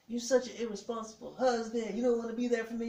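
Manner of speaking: mimicked high, loud wifely complaint; high-pitched mock scolding from a wife; upset wife. Wife reproaching husband as he leaves the hospital, upset.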